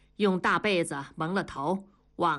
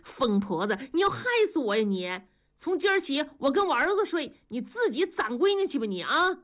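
high-pitched fleeing protest; indignant yelling; 7 semitones higher than usual. Acted. Husband bolting from his wife, indignant yelling. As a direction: cry out in exasperation, pitch climbing high. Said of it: "大庄光着俩大腿喊".

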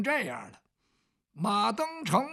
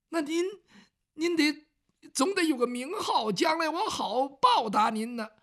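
put-on higher, eager pleading old man; pleading commoner; 7 semitones higher, 3 dB louder than usual. Ma Dengcheng pressing Bao Gong for his name, higher and eager. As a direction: beg for the name so you can repay him.

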